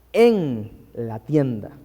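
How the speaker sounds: "en" very drawn out, then a pause; stress on EN. Shown as a demonstration. Preacher explaining Moses is now inside, "en" stretched and stressed. Repeats the contrast with desde.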